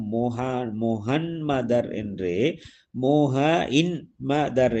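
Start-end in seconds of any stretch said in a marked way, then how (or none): 0.8-1.9 முஹன்மதர் said slowly as mo-han-ma-dar; slow demonstration of முஹன்மதர் with a clear han; n-for-m mispronunciation. Shown as a demonstration. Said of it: "சரியாக சொல்ல, சொல்ல முடியாதன் காரணமாக". 3.0-4.8 முஹ இன் மதர் broken into mo-ha, in, ma-dar; n-for-m mispronunciation. Shown as a demonstration. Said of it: "என்று சொல்லி வந்தார்கள்".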